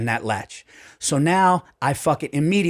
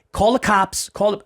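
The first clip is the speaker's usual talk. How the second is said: urgent demand; 8 semitones higher, 5 dB louder than usual. Retold or imitated. Demanding hotel call police.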